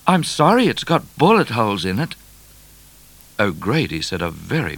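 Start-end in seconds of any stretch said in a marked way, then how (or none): 0.1-2.1 high-pitched cheeky apology; lightly raised, higher voice; mock apology. A narrator's reading. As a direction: call back a teasing sorry. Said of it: "offered Midnight".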